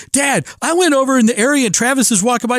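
excited son. Retold or imitated. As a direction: gush it like a thrilled young son. Son voiced retelling meeting.

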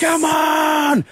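high, loud victory yell; big cocky sports shout; loud celebrating shout. Retold or imitated. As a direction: roar it like you just won the point. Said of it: "started celebrating every point incredibly loudly".

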